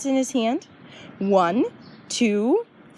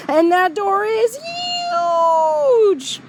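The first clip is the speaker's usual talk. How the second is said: huge door; 12 semitones higher, 4 dB louder, much slower than usual. Acted. Stressing how huge the door is.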